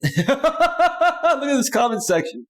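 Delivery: high, drawn-out laugh running into the words. Real, not acted. Streamer reacting to his comment section, laughing. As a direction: crack up, then talk through it.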